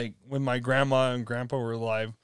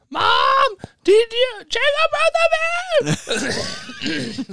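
very high, loud frantic phone call; frantic mom; 4 dB louder, much slower than usual. Retold or imitated. Mom shouting to grandma on the phone. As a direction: panic at your own mother.

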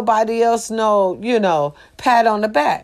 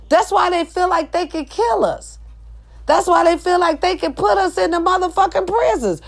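angry rant; 8 semitones higher than usual. Real, not acted.

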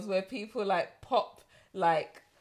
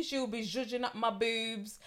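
ordinary delivery

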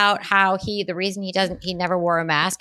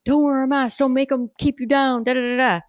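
mocking Trump slogan; 6 semitones higher than usual.

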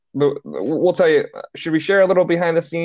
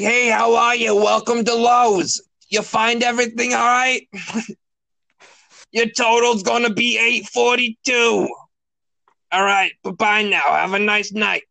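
put-on old smoker's voice; 8 semitones higher than usual. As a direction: play Roz from Monsters, Inc. working a store register. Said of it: "like, an old smoker's voice".

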